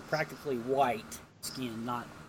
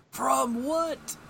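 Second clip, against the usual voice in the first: asking voice; 10 semitones higher, 3 dB louder than usual. Acted. The world asks, quoted.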